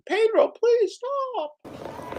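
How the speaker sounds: very high, slow begging squeak; slow squeaky plea. Pretend. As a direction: stretch the whine out. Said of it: "what he should say to himself".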